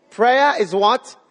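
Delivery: slow rising question on "Prayer"; call to congregation. Shown as a demonstration. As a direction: pull the answer out of the crowd.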